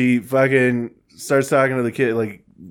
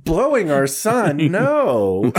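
much higher, slow mock-shocked denial; hammed-up denial; 8 semitones higher, much slower than usual. Retelling the actress denying it to her husband, hammed-up. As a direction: deny it with exaggerated innocence. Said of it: "and she's like".

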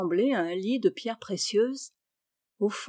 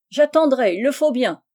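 loud, high grumble called down; grumbling shout; 4 semitones higher, 8 dB louder than usual. A narrator's reading. Chabre grumbles down to Hector, loud grudging shout. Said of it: "cria-t-il".

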